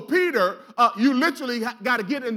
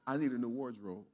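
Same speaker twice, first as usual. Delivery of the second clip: much softer and lower, sincere; dead serious; 12 semitones lower, 12 dB quieter than usual. Shown as a demonstration. Preacher telling the person beside you, softer, dead serious. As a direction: say it straight to their face. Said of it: "dead serious".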